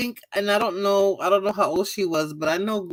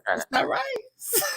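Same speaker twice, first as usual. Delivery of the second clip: high, slow, laughing mock cry; laughing wail; 9 dB quieter, much slower than usual. Repeating the rice verdict to the niece, high laughing mock wail.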